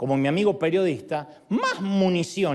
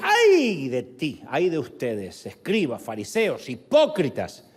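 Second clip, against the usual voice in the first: Jesus rebuking; slower than usual. Retold or imitated. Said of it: "Y Jesús decía".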